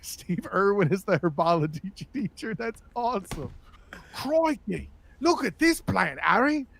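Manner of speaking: Steve Irwin style Aussie voice; Australian accent. Pretend. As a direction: play the Aussie herbology teacher. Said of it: "can you imagine Steve Irwin".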